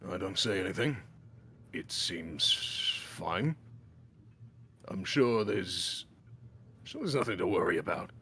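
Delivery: a hint of a calm dragonborn. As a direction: brush it off like nothing's wrong. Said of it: "he says".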